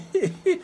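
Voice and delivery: short high giggle; amused giggle. Real, not acted. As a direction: chuckle at your own joke, high and brief. Chuckles at own whip remark.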